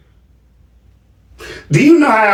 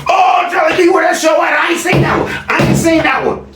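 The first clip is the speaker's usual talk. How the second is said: mock viewers. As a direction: whine like impatient fans. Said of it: "I know y'all gonna be like".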